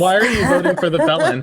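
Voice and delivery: real laugh breaking into the question. Real, not acted. Host pressing an insulting caller, laughing. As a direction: ask it through laughter, pitch lifted.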